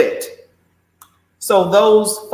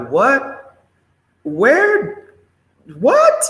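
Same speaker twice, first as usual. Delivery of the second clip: rising, ever higher what, where, what; mock bewildered; 8 semitones higher, much slower than usual. Host imitating her own stunned reaction to the news, mock bewildered. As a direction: play the stunned onlooker, climbing up. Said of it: "I just sit back and just be like".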